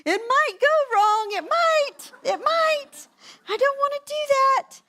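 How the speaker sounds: hammed-up high, loud wail; mock-panicked child protesting at the top of the voice; imitated meltdown. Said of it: "just full-on meltdown".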